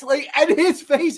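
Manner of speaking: normal talking voice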